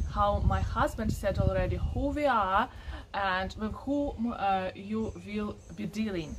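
usual speaking voice

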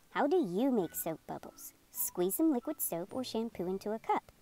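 plain reading voice

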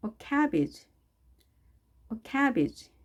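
"cabbage" with a clear "a" as in cat; high-pitched model of "cabbage", first vowel exact; 정확한 '애' 발음. Shown as a demonstration. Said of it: "에 발음 정확하게 하시고요". ("cabbage"의 '애'를 정확하게)

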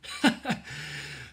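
breathy burst of laughter; hard laugh. A narrator's reading. Higgins amused by Ellen's snatch, bursting laugh. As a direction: laugh at the child's quick trick. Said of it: "Higgins laughed so hard".

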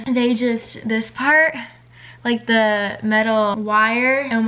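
speaking while pulling lip back; fingers in mouth. Real, not acted. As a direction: lips held open by fingers. Seen 1: Fingers hold lips open showing braces while speaking, then drop.

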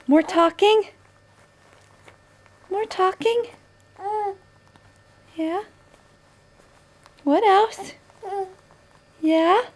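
high, rising little questions. Real, not acted. Mother answering baby's babble.

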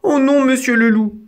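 high-pitched little plea; pleading mouse. A narrator's reading. Mouse begging the wolf not to eat her, pleading. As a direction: beg as a small, high-voiced mouse. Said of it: "supplia Didi".

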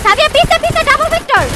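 high, loud alarmed warning; alarmed shout. Real, not acted. Warning enemies behind.

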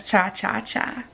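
low, hushed repeat; quiet low chant. Acted. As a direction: drop it down and soft. Repeat of tiny dino line.

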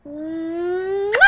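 big drawn-out kiss noise; exaggerated "mwah" rising in pitch; blown kiss. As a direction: send a showy smooch goodbye.

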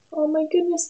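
ordinary delivery

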